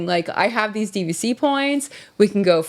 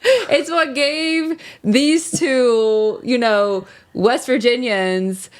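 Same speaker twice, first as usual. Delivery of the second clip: laughing through mock tears; hammed-up choking up; 5 semitones higher, slower than usual. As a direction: ham up the emotion, laughing. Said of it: "play into it. Play it up".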